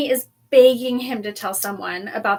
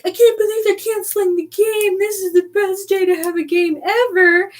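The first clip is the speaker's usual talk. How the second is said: high, whiny crowd complaint; hammed-up indignant fans; mock outrage; 8 semitones higher than usual. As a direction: wail it in a put-on high voice. Acting out everyone complaining about cancellation.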